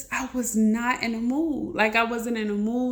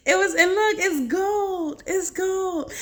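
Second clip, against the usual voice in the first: excited; 8 semitones higher than usual. Real, not acted. Showing her followers the gold Bible, thrilled and high. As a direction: gasp it out like treasure found.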